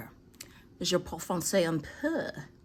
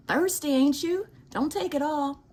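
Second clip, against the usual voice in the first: teasing the bird; 10 semitones higher, 4 dB louder than usual. Real, not acted. Teasing the hummingbird.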